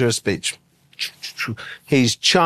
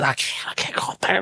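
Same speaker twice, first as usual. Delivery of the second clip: hushed, urgent whisper; panicked whisper; 3 semitones lower, 6 dB quieter than usual. Retold or imitated. Hiding in the stairwell, whispering to his buddy. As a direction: whisper it so nobody hears you.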